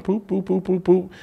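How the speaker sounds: quick, high, soft "poop" pops; light string of popping noises; popping dots sound. Retold or imitated. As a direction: tick off dots with little imitated pops. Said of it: "the dots are going".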